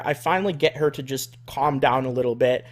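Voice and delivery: usual speaking voice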